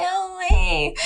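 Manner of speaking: high, mock-friendly hello; put-on high greeting; his greeting voice. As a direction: imitate his airy high "hey". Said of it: "comes up to me like".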